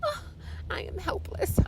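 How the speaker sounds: breathy whispered helplessness, hammed up; mock helpless whisper. Whispering to the receptionist that she is helpless, mock scared. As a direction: whisper it like a frightened damsel.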